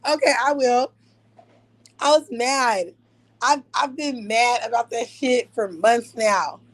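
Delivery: ordinary talk, nothing marked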